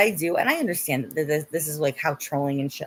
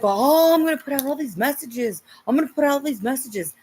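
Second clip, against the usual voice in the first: high, sneering mock voice; 7 semitones higher than usual. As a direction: sneer it like a dismissive gossip. Mocking the group.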